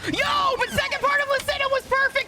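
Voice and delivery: real triumph, stretched out; excited shouting. Real, not acted. Celebrating a perfect segment.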